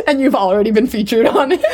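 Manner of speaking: talking through real laughter; laughing. Real, not acted. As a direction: crack up while adding to the joke. Laughing at co-host's podcast joke.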